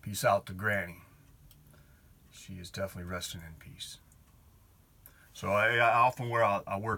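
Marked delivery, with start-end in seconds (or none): 2.3-4.0 fast whispered blessing. Real, not acted. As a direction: breathe it out softly. Remembering his late grandmother.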